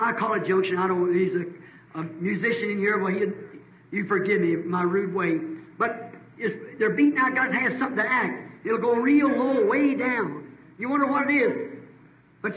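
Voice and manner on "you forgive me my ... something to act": usual speaking voice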